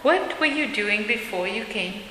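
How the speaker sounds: usual speaking voice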